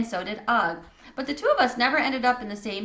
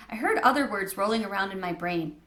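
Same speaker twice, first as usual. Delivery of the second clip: plain reading voice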